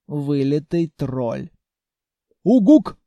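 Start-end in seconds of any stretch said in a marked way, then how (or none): 2.4-3.0 high, loud creature hoot; monster grunt. A narrator's reading. Senya holds out his hand to the girl, loud monster hoot. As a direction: hoot it out sharply. Monster's one-word utterance.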